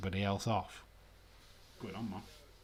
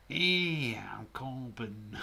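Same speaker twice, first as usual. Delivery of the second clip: badly faked accent impression; Corbyn; much slower than usual. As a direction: token attempt at someone's accent. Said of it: "can't do a Corbyn accent".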